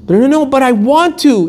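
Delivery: high, protesting wail of a plea; desperate plea. Acted. Acted plea against the refusal.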